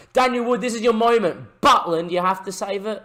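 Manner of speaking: tense excited cheer; excited shout. Real, not acted. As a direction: yell the striker toward goal. Chance on the break.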